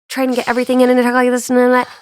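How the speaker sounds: very fast, crammed-in delivery; talking too fast. Shown as a demonstration. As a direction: rush it to demonstrate cramming everything in. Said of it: "I had to talk so fast".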